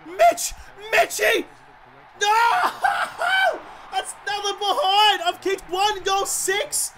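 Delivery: real high-pitched gamer despair; anguished wail. Real, not acted. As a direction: howl in despair at another miss. Wails at yet another miss.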